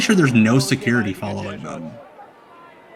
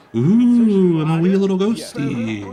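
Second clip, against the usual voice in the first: high, spooky little ghost voice; hammed-up wee ghost, pitched up; ghost impression; 7 semitones higher, slower than usual. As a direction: moan it like a tiny cartoon ghost. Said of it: "Also my ghost impression".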